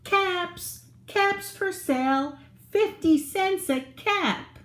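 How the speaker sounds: high sing-song street call; a hawker crying his caps, pitched high; calling out wares. A narrator's reading. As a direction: call it out to the whole street like a peddler. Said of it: "he called".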